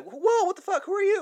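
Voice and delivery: high, loud startled scream; panicked screaming. Retold or imitated. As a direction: shriek in sudden panic. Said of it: "And she's like".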